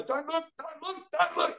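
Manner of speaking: high, hushed self-warning; high-pitched retold childhood dread; mock panic. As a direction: squeak it like a kid telling himself not to. Retelling childhood dread of looking.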